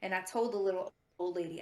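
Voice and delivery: usual speaking voice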